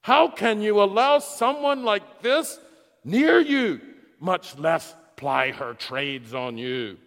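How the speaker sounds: loud, high outraged yelling, slowing at the end; furious scream. Retold or imitated. The host Simon screams for the woman's removal in fury. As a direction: bellow at him to throw her out. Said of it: "he screamed".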